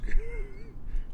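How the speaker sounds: high laugh. Real, not acted. Dog takes treat oddly.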